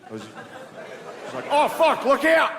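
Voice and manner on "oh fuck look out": much higher, hammed-up Australian accent. Australian office guy on nine eleven, mock Australian accent. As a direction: play a panicked Australian, Australian accent. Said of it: "an Australian guy in the office".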